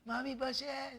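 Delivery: high, sing-song mockery of a kid brother; little brother's taunting laugh. Little brother mocking the sibling who got smacked, high taunting laugh. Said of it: "my brother started laughing".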